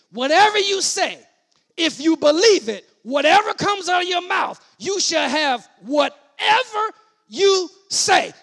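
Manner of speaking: high, loud, driving sermon shout; full-force preaching. Real, not acted. Preacher tells congregation what Jesus meant about faith, full-force preaching. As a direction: preach it at the top of your voice.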